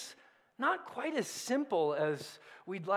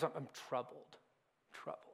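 voicing Jesus troubled; 7 semitones lower, 12 dB quieter than usual. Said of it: "He goes".

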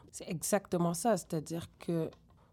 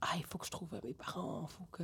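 soft, half-hearted mock lament; mock fretting; 8 dB quieter than usual. Adoptee voicing a search she never felt, soft mock fretting. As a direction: play at pining, quietly, without meaning it. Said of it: "j'ai jamais été, euh".

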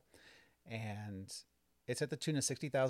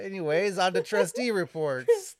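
laughing; 9 semitones higher, 14 dB louder than usual. Real, not acted. Recovering from laughter.

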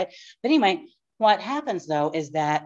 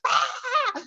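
anguished cry. Real, not acted. Recalling her grief.

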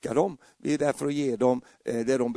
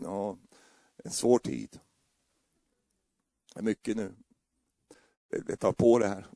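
gloomy husband; 6 semitones lower, 4 dB quieter than usual. Retold or imitated. Husband voiced gloomy in story.

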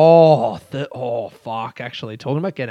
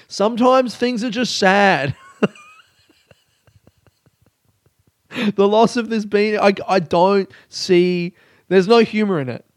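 mock-tragic grief; 6 semitones higher, 4 dB louder than usual. Comedian on his podcast, mourning his lost beanie, mock tragic. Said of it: "I'm trying to get angry".

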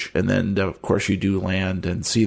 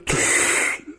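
wheezy gasp; 3 dB quieter than usual. Acted. NPC uses inhaler.

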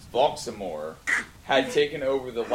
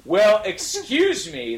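mock angry shout, higher and slow; shouted angrily; 12 semitones higher, 4 dB louder, slower than usual. A narrator's reading. Both boys snap back at the narrator, a mild mock angry shout. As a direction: drag it out like a hammed-up indignant shout. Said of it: "they both shouted angrily".

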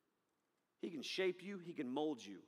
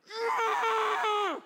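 high loud laughing shriek; toddler shriek; 8 dB louder than usual. Retold or imitated. Preacher imitating his son, high toddler shriek. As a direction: squeal like a raging baby. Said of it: "he'll be like".

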